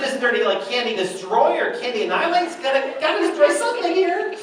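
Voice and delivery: high, loud, hammed-up pleading; comic desperation. Husband hunting a substitute game for his wife, comic desperation. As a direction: plead high, building to a mock-frantic peak.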